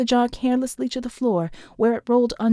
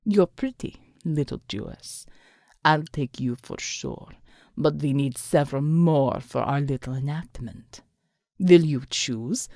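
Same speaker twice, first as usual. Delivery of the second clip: low, slow leering taunt; low taunting villain; 7 semitones lower than usual. A narrator's reading. The thug leers at Alma, low and slow. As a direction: savour the cruelty slowly.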